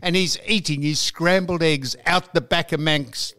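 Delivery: usual speaking voice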